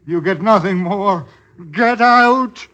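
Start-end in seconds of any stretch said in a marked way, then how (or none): 1.7-2.7 loud, higher get out; shouted order. Acted. John ordering Goody Copper out, shouting. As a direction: bark it at her to leave.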